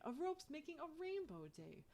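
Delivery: usual speaking voice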